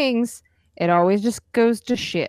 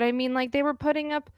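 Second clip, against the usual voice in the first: usual speaking voice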